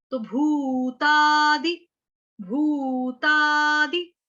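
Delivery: भूतादि very slowly, holding भू and ता long; long vowels stressed. Shown as a demonstration. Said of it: "ता भी दीर्घ स्वर".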